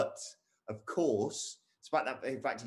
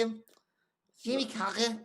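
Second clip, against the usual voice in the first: higher, breathy whispered Scouse set phrase; Scouse warm-up phrase; 12 semitones higher than usual. Shown as a demonstration. Host warming up his Scouse accent for co-host, whispered high. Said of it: "So we saw with the Scouse, I have to".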